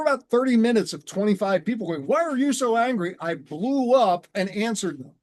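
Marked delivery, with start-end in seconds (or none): 2.1-3.1 imitated pressing question, raised pitch; insistent questioning. Twenty-five people press him after thirty minutes, insistent. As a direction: keep pushing, voice pitched high.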